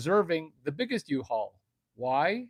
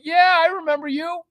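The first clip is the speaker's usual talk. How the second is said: very high, loud phony delight; hammed-up excited yes, pitched way up; fake enthusiastic recognition; 10 dB louder than usual. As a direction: gush as if greeting an old customer. Manager faking recognition of caller.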